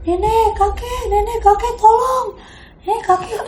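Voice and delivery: high desperate cry to grandparents; very high pleading yell; calling for help. Retold or imitated. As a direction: scream for help into the night. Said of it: "gue teriak".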